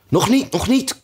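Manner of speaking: high-pitched, urgent rabbit voice; hasty interjection. A narrator's reading. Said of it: "kwam het konijn haastig tussen beiden".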